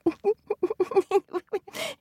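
squeaky giggling laugh; high frog giggle. Acted. Frogs laugh remembering tortoise.

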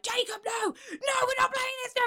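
high panicked shout. Retold or imitated. To her partner during play, high panicked shout. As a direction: yell no at him, high and frantic. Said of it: "I'm like".

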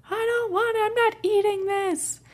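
mock tantrum wail, stretched out; screaming four-year-old. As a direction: mimic a child screaming at dinner, high and loud. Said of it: "he just kept screaming".

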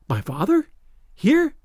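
questioning, leaping high on the last word; amazed. A narrator's reading. Girl learning her father is near, amazed. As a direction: cry it in rising disbelief. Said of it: "cried the amazed girl".

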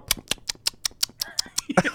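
high breathy giggle; girly giggle. Retold or imitated. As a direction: giggle like a little girl. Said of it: "and I go".

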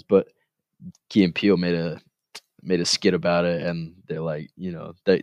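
ordinary talk, nothing marked

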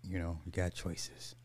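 soft whispered repeat; whispering. Real, not acted. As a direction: whisper it, unvoiced.

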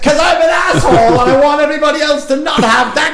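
high, loud, self-mocking shout; hammed-up yell confessing he's the jerk; mock rant. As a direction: rant like a spoilsport who owns it.

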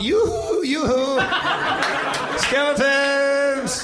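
high, sing-song hollering; calling out. Pretend. Player calls for the missing skeleton army, high calling out. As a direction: stretch it out as if hailing someone far away. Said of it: "I call out".

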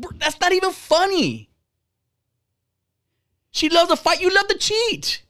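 high, disbelieving outburst; incredulous outrage. Real, not acted. Ranting at a meme poster who cheats, high and incredulous. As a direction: pitch it up in disgust.

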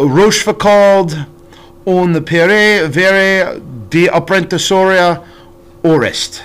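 slow, clumsy French with an American accent; foreign accent in french. Real, not acted. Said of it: "try my absolute best to try to speak French".

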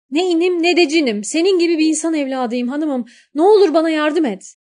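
high, loud, fast plea from the well; shouting up. A narrator's reading. Young man calls up from the well, high loud plea. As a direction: beg for rescue at full voice. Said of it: "delikanlı bağırmış".

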